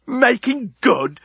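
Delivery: high, slow, incredulous yell; indignant high-pitched shout; outraged yell. A narrator's reading. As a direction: throw the words back in fury. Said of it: "yelled Leovinus".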